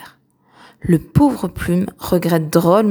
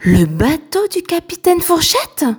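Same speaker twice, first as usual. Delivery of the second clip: nervous question; 10 semitones higher than usual. A narrator's reading. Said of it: "demande nerveusement Petit Crochet".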